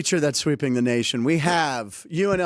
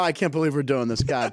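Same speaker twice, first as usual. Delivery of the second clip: normal talking voice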